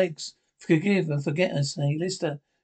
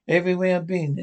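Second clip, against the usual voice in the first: ordinary talk, nothing marked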